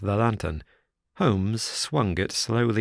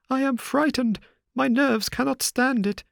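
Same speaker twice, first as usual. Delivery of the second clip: much higher, frightened nervous voice; half blubbering with fear. A narrator's reading. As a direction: near tears, a man losing his nerve. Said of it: "half blubbering with fear".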